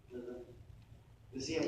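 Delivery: usual speaking voice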